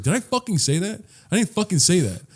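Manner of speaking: high-pitched mock indignation, not shouted; indignant retort. Professor cussing at a student who misunderstood her, indignant retort. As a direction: retell a professor's exasperated denial. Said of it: "she cussed at me".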